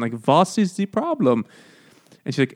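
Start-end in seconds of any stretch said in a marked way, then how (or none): 0.3-1.4 put-on higher-pitched German accent; mock German accent, pitched up. Freud questioning his patient, in a mock German accent. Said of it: "the German accent".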